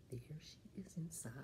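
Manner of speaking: put-on fast hushed whisper to the kids; storyteller whisper. A narrator's reading. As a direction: lean in and whisper it quickly. Aside to listening children.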